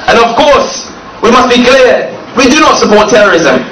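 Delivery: ordinary talk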